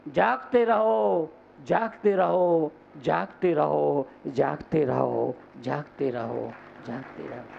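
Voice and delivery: watchman's call. Acted. Monk calling sleepers to stay awake, fading watchman's call. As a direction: repeat it like a watchman walking off into the distance.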